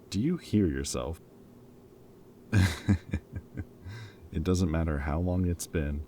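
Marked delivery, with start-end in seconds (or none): 2.5-3.6 short disbelieving burst of laughter; amused laugh at a modest son; incredulous laugh. Acted. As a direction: laugh at how silly he sounds.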